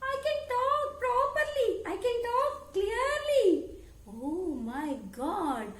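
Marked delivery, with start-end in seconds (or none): 0.0-3.7 very high-pitched parrot voice; sweet little parrot squeak; talking parrot. Acted. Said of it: "Parrot said".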